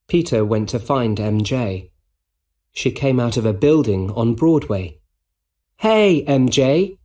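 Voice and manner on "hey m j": slow, raised friendly call. A narrator's reading. Peter calls to MJ on Broadway, calling out. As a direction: call a greeting across the street. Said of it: "called Peter".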